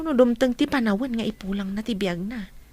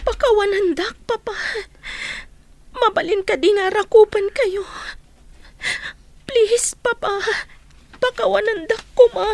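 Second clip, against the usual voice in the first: high, loud, crying plea for forgiveness; tearful plea; 10 semitones higher, 4 dB louder than usual. Acted. Daughter sobbing forgiveness to her estranged father, crying. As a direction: sob out the apology to her father.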